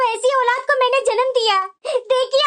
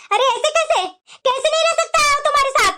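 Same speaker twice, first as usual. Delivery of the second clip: usual speaking voice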